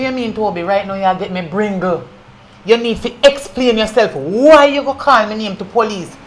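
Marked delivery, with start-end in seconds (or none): 2.6-6.1 angry accusation. Acted. Zena accuses Toby.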